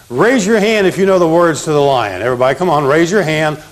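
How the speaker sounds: ordinary talk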